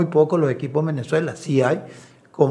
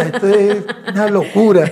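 laughing while quoting; 6 semitones higher, 6 dB louder than usual. Retold or imitated. Wife telling friends about the fans, laughing. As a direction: quote broken up by laughter.

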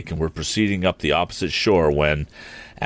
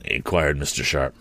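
usual speaking voice

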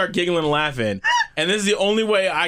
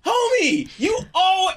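high shout; 13 semitones higher, slower than usual. Real, not acted. Protesting in playful argument.

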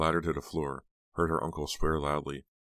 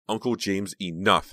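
pleading cry; 4 semitones higher, 7 dB louder than usual. A narrator's reading. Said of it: "Heather cried".

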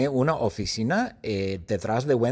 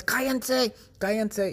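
grito fingido; 11 semitones higher, slower than usual. Acted. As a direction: yell for silence in a high voice. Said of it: "les gritaba a las verrugas". (grito agudo e impotente, actuado)

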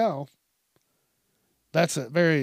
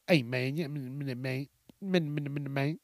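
put-on punk kid mumble; 2 semitones lower, 8 dB quieter than usual. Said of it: "he sounded like one of those little fucking punk-ass kids".

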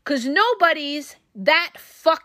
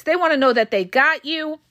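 ordinary delivery

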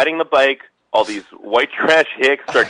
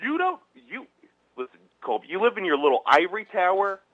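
mock rant; 5 semitones higher, 3 dB quieter than usual.

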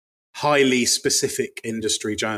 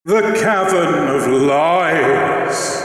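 slow booming cave voice, louder; cave echo; 3 semitones higher, 5 dB louder, much slower than usual. Shown as a demonstration. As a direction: intone it slowly from a cavern. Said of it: "cave, cave voice though".

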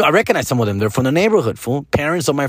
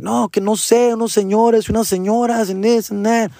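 put-on whiny kid; 10 semitones higher than usual. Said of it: "And I'm like".

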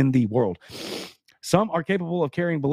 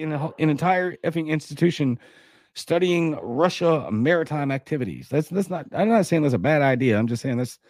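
usual speaking voice

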